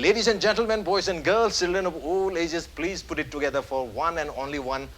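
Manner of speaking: slow, drawn-out showman announcement; announcer intro. Acted. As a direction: build up the guest's entrance slowly. Host's show introduction.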